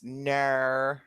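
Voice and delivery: drawn-out Aussie "Nar"; Australian accent. Acted. Co-host imitating a cartoon, Australian accent. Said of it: "it was a Bluey".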